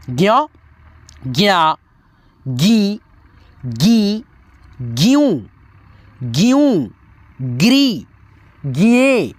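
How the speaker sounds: slow teacher chanting each syllable apart; syllable chart recitation. Shown as a demonstration. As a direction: drill the barakhadi one syllable at a time.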